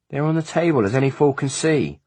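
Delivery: high, stolid servant voice; blunt, unmoved manservant. A narrator's reading. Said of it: "replied stolidly".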